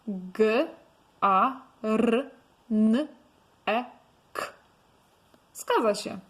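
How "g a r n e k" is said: G, A, R, N, E, K spoken one by one with pauses; sounding out letters. Shown as a demonstration. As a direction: spell garnek slowly sound by sound. (głoska po głosce, powoli)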